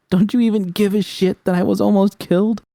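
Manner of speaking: hurt and furious, voice cracking up; anguished scream. Acted. Narrator screams at V that she was almost killed.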